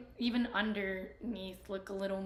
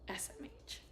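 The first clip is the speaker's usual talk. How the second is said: whispered scoff; 8 dB quieter than usual. Real, not acted. Muttering scorn to self.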